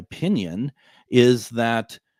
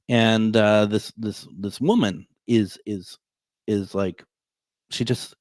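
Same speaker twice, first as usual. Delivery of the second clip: ordinary talk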